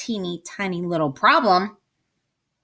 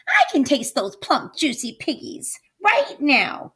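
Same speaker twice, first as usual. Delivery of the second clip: higher, lip-smacking witch craving; greedy witch voice; 7 semitones higher than usual. Acted. Witch savoring the thought of plump piggies, higher and greedy. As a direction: relish every word like a hungry hag. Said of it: "Gritch said, smacking her lips".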